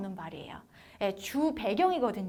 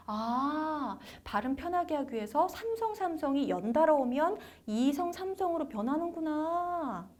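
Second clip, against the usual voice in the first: realising student; 4 semitones higher than usual. Acted. Student grasping the tone rule, high realisation. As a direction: work it out aloud, lifting the pitch.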